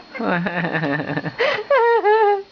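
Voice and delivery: high teary laugh; crying laugh. Real, not acted. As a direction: laugh till it cracks. Laughing hard.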